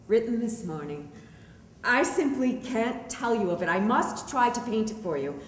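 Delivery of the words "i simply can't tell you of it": plain reading voice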